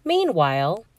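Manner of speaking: plain reading voice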